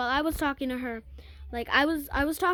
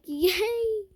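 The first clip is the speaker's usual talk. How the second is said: mock cheer; 7 semitones higher, 3 dB louder than usual. Friend cheers at making up.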